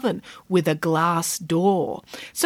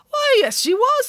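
very high pig voice; squeaky bright character voice; Nanny Piggins voice. A narrator's reading.

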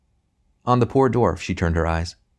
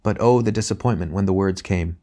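usual speaking voice

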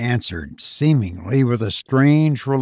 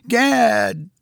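sudden higher exclamation in an odd voice; strange cry; 6 semitones higher than usual. Acted. Madman crying out to the narrator, strange voice. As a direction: blurt it out in a strange voice. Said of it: "he cried in a voice and accent strange to me".

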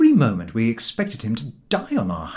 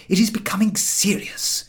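Harris; 10 semitones higher than usual. A narrator's reading. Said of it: "said Harris".

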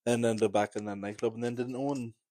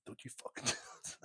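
whispered warning; 14 dB quieter than usual. Retold or imitated. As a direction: warn them off under your breath, slowly. Retelling warning friend during fall.

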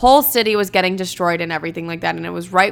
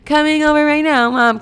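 stoned, drawn-out slur in a higher pitch; high-pitched dopey phone slurring; slurred words; 4 semitones higher, slower than usual. Shown as a demonstration. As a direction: act wasted on the phone, dragging the vowels. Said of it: "I probably sound like this".

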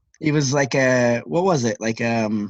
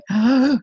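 high, loud mock gasp; imitated announcer gasping before the reveal; dramatic gasp; 11 semitones higher, 4 dB louder than usual.

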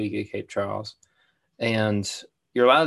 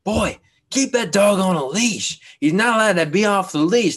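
higher, louder imitation of a bossy stranger; mock yell; 10 semitones higher, 6 dB louder than usual. Beach guy yelling at him to leash his dog, mock shout. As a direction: ham up the angry beach guy barking orders. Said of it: "he was just yelling at me".

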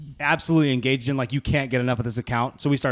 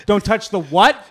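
rising, higher mock disbelief; incredulous wife; 5 semitones higher, 5 dB louder, slower than usual. Wife surfacing after hearing about sharks, retold incredulous questions. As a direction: repeat it back in alarmed disbelief. Wife pops up alarmed in story.

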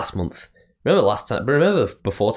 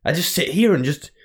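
anxious inner voice; 6 semitones higher than usual. Retold or imitated. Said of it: "I have this, like, complex".

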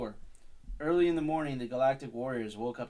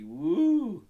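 sudden high, loud whoop; mock whoop; 8 semitones higher, 10 dB louder than usual. Real, not acted. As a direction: let out a teasing woo. Mocking cheer while reading.

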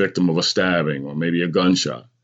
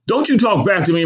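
high-pitched scolding yell; yelling mother; 3 dB louder than usual. A narrator's reading. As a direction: yell at the child for answering back. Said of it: "my mother yelled".

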